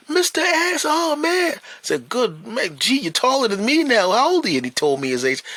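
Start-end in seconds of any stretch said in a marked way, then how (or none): 0.1-1.5 very high, happy call; excited boy. Retold or imitated. Grown boy running out to greet him, very high. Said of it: "he comes running out". 2.1-4.6 high, loud surprise; amazed greeting. Retold or imitated. Man marvelling at the grown boy's height, high and amazed.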